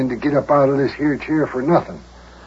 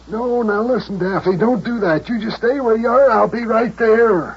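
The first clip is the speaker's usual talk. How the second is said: 高声急切地劝; 急得提高嗓门, 最后放慢; 8 semitones higher than usual. Acted. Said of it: "Boy, he sure is excited".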